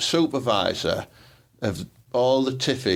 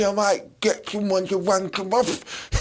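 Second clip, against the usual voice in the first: high, slow put-on party-girl voice; sing-song impression of a student on a night out; mock student girl; 8 semitones higher, slower than usual. Friend mocking a politician's student days, high drawled voice. Voicing Angela Rayner at university.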